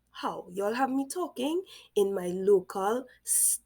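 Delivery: ordinary talk, nothing marked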